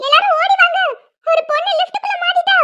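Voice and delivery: urgent shout for help; alarmed calling out. Acted. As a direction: rouse everyone to run over. Alarmed, calling everyone over.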